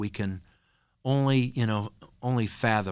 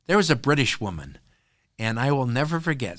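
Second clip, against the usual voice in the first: ordinary talk, nothing marked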